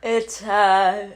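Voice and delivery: talking through a yawn; sleepy, mid-yawn words. Real, not acted. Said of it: "I keep yawning".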